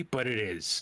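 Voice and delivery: ordinary talk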